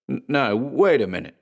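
nervous, stumbling start; stammering protest. Acted. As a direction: stutter it out anxiously.

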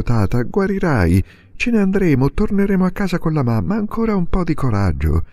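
joyful encouragement. A narrator's reading. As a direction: lift him up with sudden hope. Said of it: "con accento quasi di gioia".

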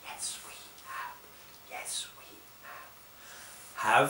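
soft whispered answer. Shown as a demonstration. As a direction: whisper the reply. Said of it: "whispering voice".

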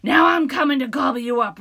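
very loud troll roar. Acted. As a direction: roar the threat. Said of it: "roared the troll".

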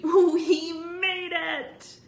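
loud, high, long-drawn whoop; cheer, stretching every word; triumphant cry. A narrator's reading. Boy celebrating with friends on the street, high drawn-out triumphant whoop. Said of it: "Bird cried happily".

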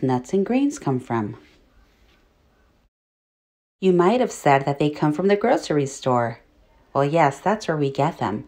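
ordinary talk

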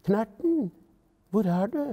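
lightly voiced call for someone lost. A narrator's reading. Lillebror calls for lost Knerten, calling out.

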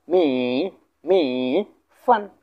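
"মি" with a long drawn-out vowel, "ফান" short after a pause; syllable-by-syllable pronunciation. Shown as a demonstration. Teacher pronouncing the whole Chinese word for learners, syllable by syllable. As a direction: stretch "মি", then set "ফান" apart. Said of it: "উচ্চারণ করতে যাই".

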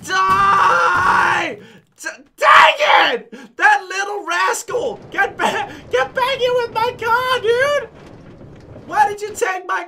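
outraged gamer shouting at the car thief; furious yell. Real, not acted. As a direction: scream after the thief who stole the car. Car stolen, furious chase.

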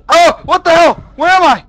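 high, loud cry of bewilderment; alarmed confusion. Real, not acted. Player suddenly lost in the game, panicked shout. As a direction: yell it, startled and lost.

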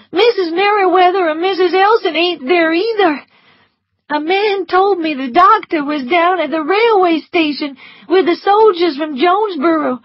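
put-on high, tearful servant girl voice; Prissy near tears with bad news; frightened Prissy. A narrator's reading. Prissy blurting war news to Scarlett, high and tearful.

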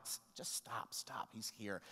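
whispered, calming himself; near-whisper, reining in panic; hushed self-talk. Retold or imitated. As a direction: tell yourself under your breath to stop.

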